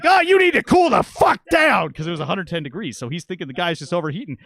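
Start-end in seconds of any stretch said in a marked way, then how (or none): 0.0-1.9 high, loud, slow shout. Retold or imitated. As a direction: yell it across the house. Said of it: "I was like".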